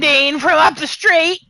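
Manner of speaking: high, loud mock British accent. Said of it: "Sounds like a nice British couple".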